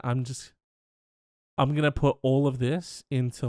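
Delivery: ordinary talk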